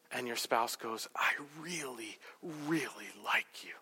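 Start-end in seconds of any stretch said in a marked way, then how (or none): 1.1-3.7 slow, hushed whisper; hedged affection. Acted. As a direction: whisper it haltingly to the spouse. Spouse's hedged reply, acted.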